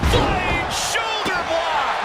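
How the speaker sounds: high, slow, drawn-out yell; excited shout. Acted. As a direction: sell the big move with a shout. Big move called on commentary.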